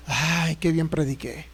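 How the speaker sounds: soft, slow, self-satisfied sigh; smug self-praise. Retold or imitated. Preacher pleased after stoning the flock, smug sigh. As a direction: purr it with mock smugness, slowly.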